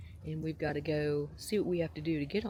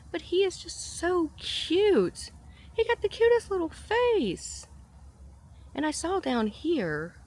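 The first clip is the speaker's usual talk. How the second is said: very high, louder, slow cooing; 13 semitones higher, 4 dB louder than usual. Real, not acted. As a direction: melt over how cute he is. Gushing over capybara.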